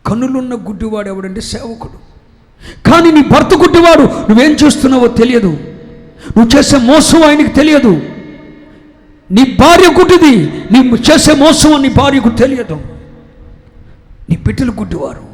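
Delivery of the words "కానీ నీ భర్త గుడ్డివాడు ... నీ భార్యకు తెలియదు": loud, high, impassioned sermon; preacher in full flight; fiery preaching. Acted. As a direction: thunder it at the congregation.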